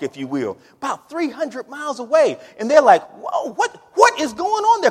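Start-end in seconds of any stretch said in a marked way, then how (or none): 3.1-4.9 high-pitched acted bewilderment; astonished. People back home hearing of changed lives, astonished. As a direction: voice leaps up in mock amazement. Townspeople react to astonishing news.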